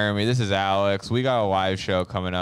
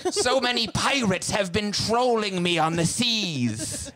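whiny British admiral; 12 semitones higher, slower than usual. Retold or imitated. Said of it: "British people are like".

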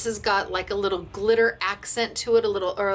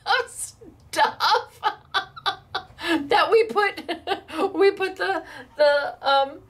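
laughing; 6 semitones higher, slower than usual. Real, not acted. Laughing at the bottles.

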